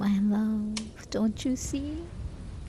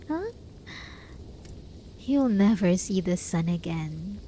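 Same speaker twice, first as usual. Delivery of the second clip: ordinary talk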